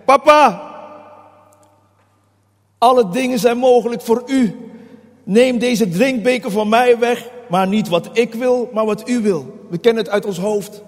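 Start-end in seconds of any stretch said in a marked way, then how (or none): 0.0-9.7 raised, urgent prayer, sinking toward surrender; pleading cry to papa. Acted. Preacher voices Jesus praying to his Papa, pleading cry. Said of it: "Eigenlijk zegt hij".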